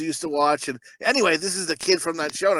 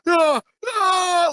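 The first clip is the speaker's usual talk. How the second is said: high, hammed-up yells of agony; overacted scream; 10 semitones higher, 4 dB louder than usual. Mocking an actor whose head catches fire, overacted high scream. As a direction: mimic over-the-top screaming. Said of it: "it's overacting".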